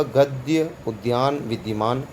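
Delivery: गद्य, उद्यान, विद्यमान read slowly with a clear y after d; slow model reading of गद्य उद्यान विद्यमान; correct reading. Shown as a demonstration. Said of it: "अब मैं सही शुद्ध पढ़ूंगा".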